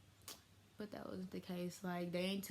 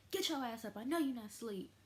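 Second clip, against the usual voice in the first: higher-pitched mock auntie telling-off; imitated scolding; 5 semitones higher than usual. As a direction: act out an auntie calling out a faker. Auntie rouses friend in retold scene.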